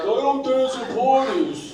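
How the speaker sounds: slow, high whining voice; husband refusing to dance at a wedding; mock sulky husband. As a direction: drag it out like a petulant man.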